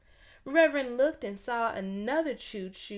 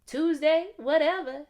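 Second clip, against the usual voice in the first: teasing; 6 semitones higher, 8 dB louder than usual. A narrator's reading. Teasing joke, brothers laughing.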